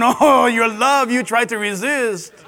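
slow mock French crooning; lightly hammed French lover; Pepe Le Pew voice. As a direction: woo the fleeing cat in a fake French accent. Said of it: "and he's going".

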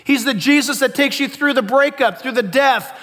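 loud, building sermon delivery; impassioned preaching. Real, not acted. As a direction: press each point home to the congregation. Preaches with rising force.

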